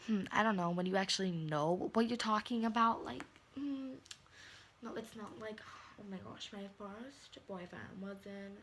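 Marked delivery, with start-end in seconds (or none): 0.1-3.0 loud firm lecture, no no no; loud scolding. Real, not acted. Vlogger scolding young kids who date, loud.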